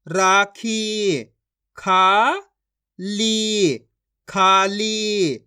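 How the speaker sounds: each syllable stretched long and held; very slow syllable-by-syllable reading demo; drawn-out pronunciation. Shown as a demonstration. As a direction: draw out every vowel like a reading teacher. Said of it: "खींच खींच के".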